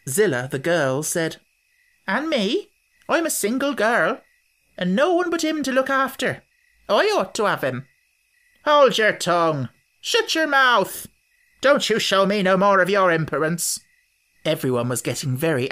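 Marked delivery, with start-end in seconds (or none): 2.1-7.9 put-on higher girl's voice, pleading her claim; lightly voiced single girl asking. Zillah claiming the baby, higher girlish plea. 8.7-13.8 louder, snapping at a rival; angry scolding. Acted.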